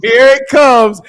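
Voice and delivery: loud, drawn-out here it comes; teasing shout. Acted. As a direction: call it out like a warning siren.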